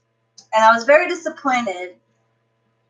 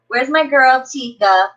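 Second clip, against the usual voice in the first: ordinary talk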